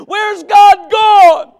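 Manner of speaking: slow, high, shouted wail; acted grief at full shout, words stretched long; anguished tearful cry. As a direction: wail it out as the weeping woman. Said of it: "Her eyes started to moisten".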